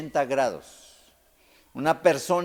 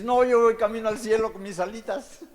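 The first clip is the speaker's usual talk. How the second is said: high, louder, self-satisfied sing-song; smug mock quote; 8 semitones higher, 5 dB louder than usual. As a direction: play the smug would-be saint. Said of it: "Y dices". (imitación presumida, más aguda y fuerte)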